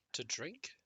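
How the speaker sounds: confused, quiet zombie. Acted. Confused zombie given mead.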